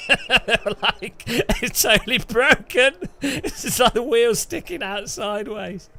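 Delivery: laughing mock Dutch accent; giggly put-on Dutch accent. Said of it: "That's my Dutch".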